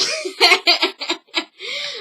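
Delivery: high burst of laughter; real laugh. Real, not acted. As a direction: crack up at the story. Laughing at own story.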